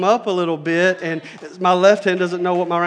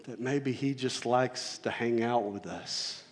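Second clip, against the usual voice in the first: tender; 7 semitones lower, 12 dB quieter than usual. Real, not acted. Tender soft line.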